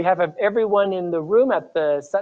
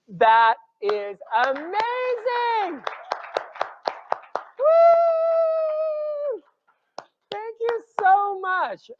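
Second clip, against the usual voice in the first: excited; much slower than usual. Real, not acted. Host reacts to fundraising total.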